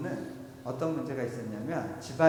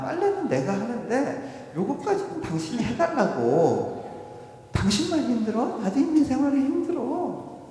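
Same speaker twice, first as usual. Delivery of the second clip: raised, high-pitched nagging; exasperated wife; 8 semitones higher, 5 dB louder than usual. Acted. Wife scolds husband over laundry duty, exasperated. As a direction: complain loudly, pitch climbing.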